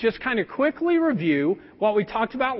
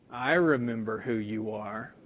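much lower, quiet, deadpan; flat low retort; 12 semitones lower, 6 dB quieter than usual. Retold or imitated. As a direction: drop the voice low and flat, giving nothing away. Retold meeting with old classmate.

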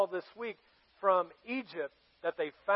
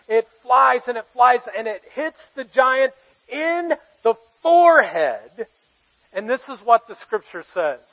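loud and higher, preaching at full force; excited shouting; 6 semitones higher, 14 dB louder than usual. Real, not acted. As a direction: shout out the triumph. Climax of the story.

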